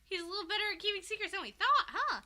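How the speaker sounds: smug Cardinal. Retold or imitated. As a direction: needle them with a sly taunt. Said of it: "the Cardinal's like".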